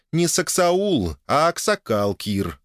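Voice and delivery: slow, high-pitched scoffing correction; amused snort. A narrator's reading. Wife correcting husband's word, lightly scoffing. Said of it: "фыркнула жена".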